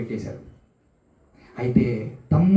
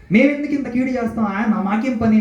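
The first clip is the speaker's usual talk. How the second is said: sharp, high-pitched outrage at the charge; serious protest; 8 semitones higher than usual. Retold or imitated. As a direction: protest like you're wrongly accused. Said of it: "చాలా సీరియస్గా మాట్లాడారు".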